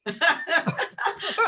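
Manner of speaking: high burst of laughter. Real, not acted. Speaker breaks up before making a point, laughing.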